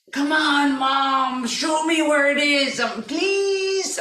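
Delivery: drawn-out wheedling plea to Mom; pleading child. Retold or imitated. Child begging mother to show him.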